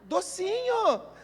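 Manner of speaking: put-on high, slow syrupy calling of a child; sweet mom call. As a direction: coax the kids upstairs with stretched sweetness. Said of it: "Aí ela assim".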